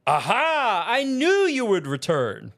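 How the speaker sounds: put-on flamboyant, high-pitched young man; Summoner James. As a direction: greet them with a theatrical flourish up high.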